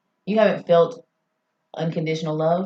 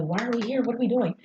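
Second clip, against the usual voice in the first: mock-crying plea, pitched high and softer; lightly acted despair, raised pitch; retold tearful questioning; 7 semitones higher, 3 dB quieter than usual. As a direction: imitate a high, helpless lament without real tears. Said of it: "sitting on the floor bawling, crying".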